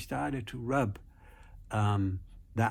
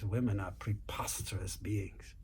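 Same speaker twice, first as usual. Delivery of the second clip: whispered grumble under the breath; exasperated whisper; 3 semitones lower, 8 dB quieter than usual. Retold or imitated. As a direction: whisper it to yourself, fed up. Exasperated snake mutters aside.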